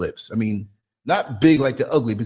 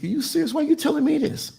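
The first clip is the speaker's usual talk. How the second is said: higher incredulous questions; shocked disbelief; 11 semitones higher, faster than usual. Retold or imitated. Driver reacting to the passenger, high incredulous. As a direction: squeak out the shock. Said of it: "I was like".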